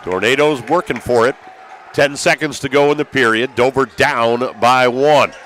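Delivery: ordinary talk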